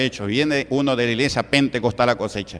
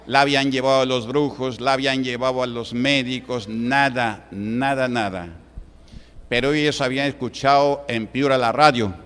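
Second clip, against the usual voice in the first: ordinary delivery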